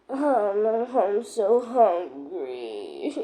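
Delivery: slow, soft, faint whine; weak with hunger. Pretend. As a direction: drag the words like you're too hungry to go on. Stranded toy characters without food.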